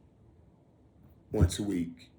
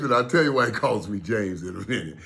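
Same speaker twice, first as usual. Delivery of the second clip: normal talking voice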